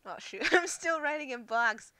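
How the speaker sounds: flustered, half-laughing realisation; startled dismay. Real, not acted. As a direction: catch your own blunder, loudly. Notices a mistake while working.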